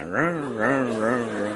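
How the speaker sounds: slow droning imitated siren hum; alarm buzz imitation. Preacher mimicking the customs alarm, slow droning buzz. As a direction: drag out a buzzing alarm sound.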